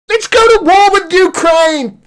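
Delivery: high, loud mock enthusiasm; mock warmonger. Commentator parodying MPs eager for war, mock-excited. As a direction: cry it out like a sarcastic cheerleader.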